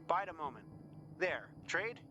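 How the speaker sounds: high call to someone off a way. A narrator's reading. Trader proposing a swap across the hill, calling out high.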